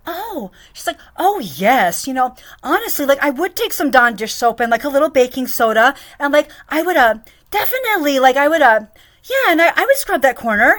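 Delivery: high, loud mocking eager voice; mock her keen answer; hammed-up eager employer; eager boss.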